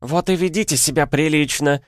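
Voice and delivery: high, exasperated nurse's bark; сердитый рявк. A narrator's reading. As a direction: bark them into behaving. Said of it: "рявкнула Диана". (рявкнуть высоко и раздражённо)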